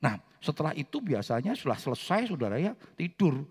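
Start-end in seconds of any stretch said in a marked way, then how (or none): none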